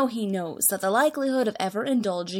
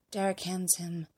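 plain reading voice

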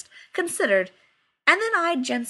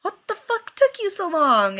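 mock crowd; 9 semitones higher, 6 dB louder than usual. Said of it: "a collective".

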